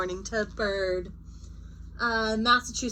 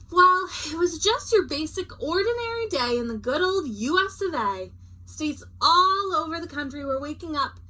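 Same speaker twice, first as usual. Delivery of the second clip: higher, lively read-aloud voice; storybook narration; 6 semitones higher, 3 dB louder than usual. A narrator's reading. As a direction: read the opening like a picture book.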